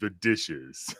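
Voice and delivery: slow, ominous parent voice; creepy slow voice. Pretend. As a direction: draw it out like a threat. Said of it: "creepy, slow voice like that".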